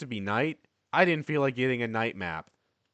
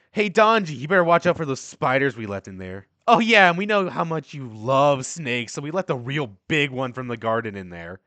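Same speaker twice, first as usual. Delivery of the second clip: taunting; 3 semitones higher, 8 dB louder than usual. Acted. Bullies taunting through the door.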